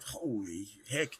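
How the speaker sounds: slow, hushed disbelief; stunned reaction. Retold or imitated. Player recalling being handed guns to go hunting, stunned. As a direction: let it out slowly, half under the breath. It was like.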